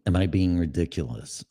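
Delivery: ordinary talk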